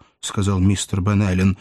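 ordinary delivery

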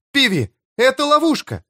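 very high-pitched shout of warning; alarmed cry. A narrator's reading. Hester warns the mayor of a trap, crying out. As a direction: cry out urgently, pitch leaping. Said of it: "Эстер крикнула".

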